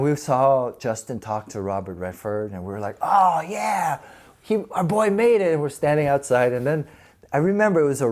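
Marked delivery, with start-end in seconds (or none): 3.0-5.5 higher, louder cheer for a buddy; excited cheer. Retold or imitated. Friends outside cheering Justin on, higher and louder. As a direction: whoop it like your friend just scored.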